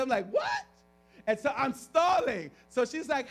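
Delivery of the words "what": very high, loud squeal of disbelief; mock-astonished yelp; shocked disbelief. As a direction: blurt it out, voice leaping up. Acted shock at the request.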